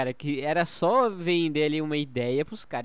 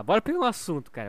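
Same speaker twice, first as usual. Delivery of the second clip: ordinary talk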